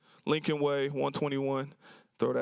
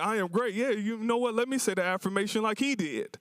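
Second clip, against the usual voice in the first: higher-pitched, delighted enthusiasm; delighted onlooker; 6 semitones higher than usual. Retold or imitated. People in line love his affirmation, delighted. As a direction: gush admiringly, pitched up. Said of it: "thought he was just happy to say it".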